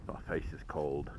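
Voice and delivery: talking through a scarf; muffled, scarf over mouth. Real, not acted. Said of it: "to get my scarf on".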